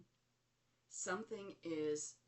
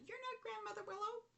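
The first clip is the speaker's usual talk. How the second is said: very high, squeaky incredulous retort; laughing disbelief; faster than usual. Retold or imitated. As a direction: imitate yourself protesting in a squeal. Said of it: "And I laughed. I said".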